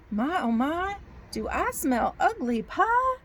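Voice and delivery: high, slow Southern drawl, rising into a question; hopeful storybook bear with a Southern accent. Acted. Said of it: "I get a Southern accent".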